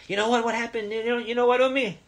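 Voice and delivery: put-on child teasing mom with a secret, high and bright; excited child. Shown as a demonstration. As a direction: squeal it like a kid with big news. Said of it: "And what do you say to her?".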